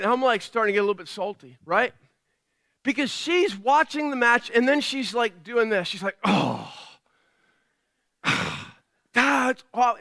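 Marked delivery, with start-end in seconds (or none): 6.2-10.0 mock exasperated sighs and groans; groaning in mock disgust at a game; imitated huffing and grunting. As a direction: huff and puff like an annoyed passenger. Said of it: "she's huffing and puffing and grunting".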